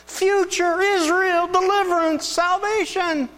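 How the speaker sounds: high, loud, slow proclaiming; ecstatic proclamation. Retold or imitated. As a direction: lift each word up in wonder. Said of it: "Can you just see him saying".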